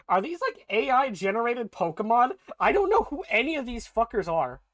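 bewildered yelling at the game; real confused shouting; baffled outburst. Real, not acted. Baffled at unknown Pokemon.

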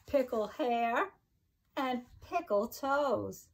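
sing-song rhyming read-aloud, each line bouncing; reading to children. Acted. Teacher reading a pickle rhyme book to her class, sing-song. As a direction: bounce the rhymes for little listeners.

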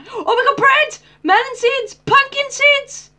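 high, loud cheers for each item; gleeful loot list. Real, not acted. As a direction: announce every find with glee. Listing loot, gleeful shouts.

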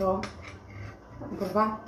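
usual speaking voice

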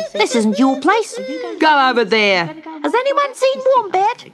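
high, loud squeaky animal calls; bossy little creatures jostling; high squeaky animals shouting over each other; animal crowd voices. A narrator's reading.

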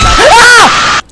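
high, loud panicked scream; terrified scream. Real, not acted. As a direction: scream as it grabs you. Caught by monster.

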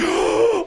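sharp high gasp of shock. Acted. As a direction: gasp in surprise. Shocked prisoners in cages.

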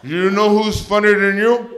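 slow, loud, high mock-mom taunt; talking smack. Tipsy mom goads her comic son, slow loud trash talk. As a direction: draw out the goading question. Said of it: "she talks smack to me".

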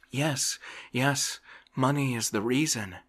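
glad eager excuse. A narrator's reading. Idle man grabbing the doctor's excuse, gladly. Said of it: "gladly catching at the excuse".

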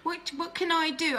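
high, panicked inner voice; reliving the fear, high and frantic; scared. Retold or imitated. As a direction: voice jumps high with fright. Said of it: "I got really scared".

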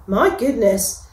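ordinary delivery

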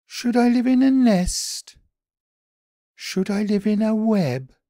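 high, small puzzled bunny voice; a high, wondering little creature; Daily B's voice. A narrator's reading.

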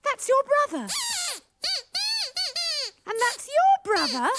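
loud, high-pitched amazement; astonished. Acted. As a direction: exclaim it in stunned realisation. Astonished to see two lookalikes.

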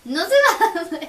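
loud, high teasing protest; excited. Real, not acted. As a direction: laugh off the prank. Teasing prank reveal.

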